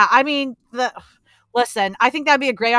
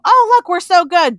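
higher, louder mocking voice; mock self-righteous; 8 semitones higher, 7 dB louder than usual. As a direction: mimic smug do-gooders, pitched up and loud.

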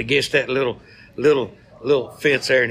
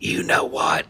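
battered bird whispering the setup; hushed, unvoiced parrot line; voiceless whisper; slower than usual. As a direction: barely breathe it out. Beaten parrot's final line.

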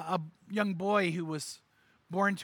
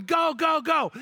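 high, louder cheering go go; crowd yell; 8 semitones higher, 4 dB louder, slower than usual. Retold or imitated. Preacher voicing the crowd cheering him on, louder. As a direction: urge him round the bases. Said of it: "they're going".

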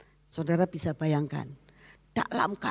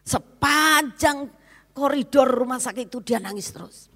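impassioned preaching; 8 semitones higher, 4 dB louder than usual. Acted. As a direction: stretch the word out in grief. Preacher rises emotionally.

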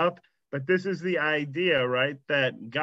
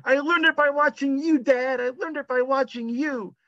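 higher, put-on teenage son voice; imitating the son from the ad; 12 semitones higher than usual. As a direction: mimic the caught kid throwing it back at Dad. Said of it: "the father confronts the son".

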